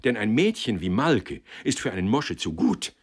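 earnest, emphatic self-justification; protesting, mildly heated rebuttal; defensive young man. Acted.

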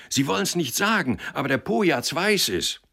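very high-pitched, loud gossip; excited crowd call. A narrator's reading. Townsfolk telling each other the news, excitedly called out. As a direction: spread the news in a high cry.

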